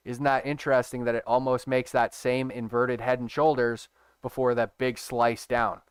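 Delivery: ordinary talk, nothing marked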